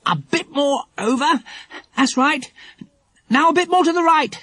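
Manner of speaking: high-pitched, effortful directions with breaths between; panting. A narrator's reading. Jack guiding the plank into place, panting. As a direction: puff out instructions while heaving a load. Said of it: "panted Jack".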